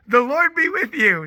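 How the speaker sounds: high, loud kiddie parroting; children repeating in chorus. Retold or imitated. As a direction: imitate a class of preschoolers echoing back. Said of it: "every single one of them say".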